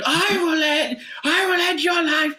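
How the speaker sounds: very high, strained mock scream; enraged threat. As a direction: scream it like a road-rage maniac, acted out. Imitates driver's roadside death threat.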